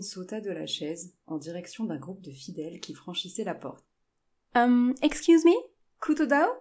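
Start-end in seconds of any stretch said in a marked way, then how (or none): 4.6-6.5 high, loud questioning call. A narrator's reading. Jane calls to worshippers for directions, high questioning hail. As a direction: hail a stranger, pitched up and rising at the end. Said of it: "lança-t-elle".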